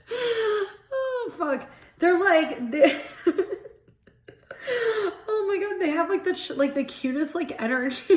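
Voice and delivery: high, breaking into giggles; can't stop laughing while talking; giggly laughing. Real, not acted. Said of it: "I don't know why I'm so giggly".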